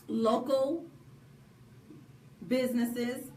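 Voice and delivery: slow and deliberate, word by word; slowed down so listeners can catch it; said slowly. Shown as a demonstration. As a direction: spell it out slowly so everyone catches it. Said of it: "I wanna say that slowly so y'all can catch it".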